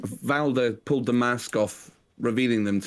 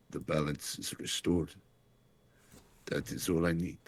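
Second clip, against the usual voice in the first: put-on fading, much softer dying words in a German accent; dying farewell; 4 semitones lower, 5 dB quieter than usual. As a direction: let the breath run out as he dies. Dying leader's last speech.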